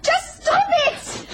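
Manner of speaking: high panicked cries of outrage; frightened screams. Acted. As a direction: shriek at him to stop. Attacked, crying out in struggle.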